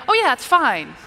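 high, loud, breezy okay; easygoing reassurance. Shown as a demonstration. Preacher showing how tone changes the message, breezy. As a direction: brush it off lightly and readily. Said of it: "Very, very different messaging there".

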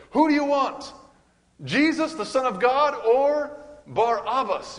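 high, loud mocking call to the crowd; crowd shouting. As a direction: belt it out like Pilate asking the mob. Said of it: "and they said".

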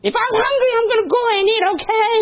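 very high, loud mock screech; hammed-up snapping back in falsetto; triggered angry outburst. Acts out a triggered angry reply.

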